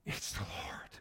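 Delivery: hushed, awed whisper. Acted. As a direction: breathe it in awe.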